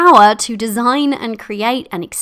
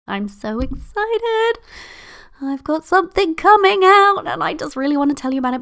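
high, squealy excited gush; bubbling over with news she can't share yet; giddy sing-song squeal; sing-songy excitement; 8 semitones higher than usual. Real, not acted. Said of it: "I get a bit sing-songy when I get excited".

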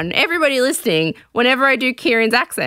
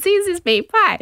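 high-pitched imitation of a suitor; lightly voiced eager suitor; acted eager offer; 9 semitones higher than usual. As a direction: play him offering a gift, pitched up. Retold suitor offering his pie.

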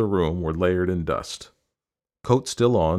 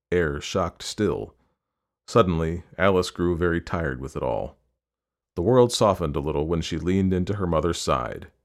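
ordinary delivery, nothing marked

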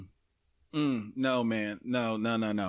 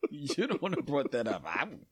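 usual speaking voice

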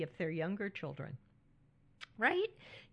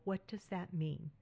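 ordinary talk, nothing marked

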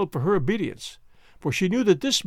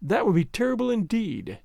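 usual speaking voice

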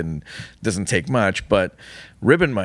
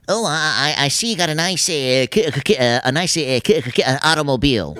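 Porky Pig; 2 semitones higher than usual. Shown as a demonstration. Explaining the bit about simple words, stuttering. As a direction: stammer on k, then swap in a longer word. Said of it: "the whole bit is like".

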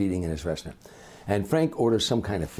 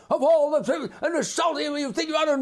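very high mock furious yell; hammed-up B movie tantrum; yelling from the kitchen. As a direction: rant at the chef from the back room. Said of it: "you hear him yelling at the chef".

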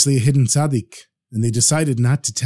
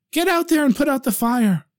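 urgent; 10 semitones higher than usual. Acted. Wife urges husband.

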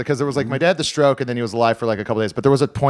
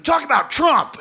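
mock heckler; 10 semitones higher than usual. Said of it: "And he goes".

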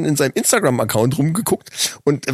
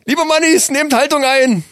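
mock ceremonial call; 9 semitones higher than usual. Announces ceremony to listeners.

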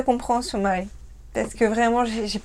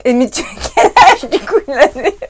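laughing; 6 dB louder than usual. Real, not acted. Asking the viewer's age again, helplessly laughing. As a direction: question broken up by giggles.

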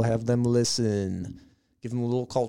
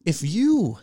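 ordinary talk, nothing marked

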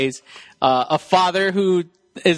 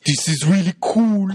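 hammy action-star impression; mock a badly acted bit of wonder; Schwarzenegger. Co-host echoing the mocked kid-movie acting, hammy impression. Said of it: "It's like".

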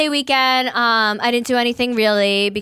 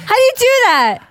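much higher and louder, thrilled squeal; genuinely wowed, voice shooting up; amazed; 13 semitones higher, 7 dB louder, faster than usual. Real, not acted. Impressed by her cohost's accent: amazed squeal.

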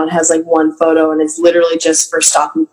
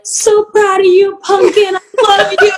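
mom voice; 13 semitones higher than usual. Retold or imitated. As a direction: coo it like an adoring mother. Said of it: "And they're like".

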